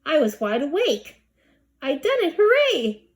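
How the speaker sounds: gleeful high cheer; rising to a happy shout; triumphant. A narrator's reading. Emily triumphant.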